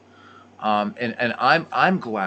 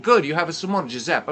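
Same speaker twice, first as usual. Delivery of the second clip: quick, higher grandma voice with an Italian lilt; imitating grandma; 8 semitones higher, 5 dB louder, faster than usual. Grandmother answering her grandson at dinner, quick Italian-nonna voice. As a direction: play his nonna pushing more food.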